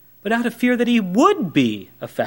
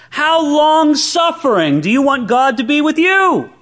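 building preacher intensity; 8 semitones higher, 4 dB louder than usual. Real, not acted. Climax of rising questions.

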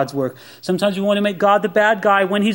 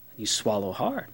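hushed; 9 semitones lower, 12 dB quieter than usual. Acted.

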